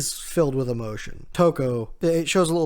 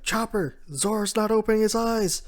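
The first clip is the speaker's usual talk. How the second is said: higher, pleading call to Chopper; urgent Franky; 9 semitones higher, 7 dB louder than usual. Retold or imitated. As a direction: cry out for the doctor, pitched up.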